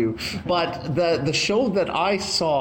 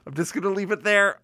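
ordinary talk

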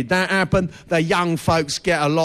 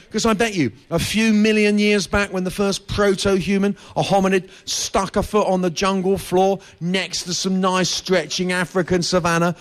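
ordinary talk

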